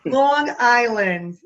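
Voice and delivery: "Long Island" drawn out in a Jersey accent; loud, slow Jersey "Long Island". Shown as a demonstration. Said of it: "And we say, like".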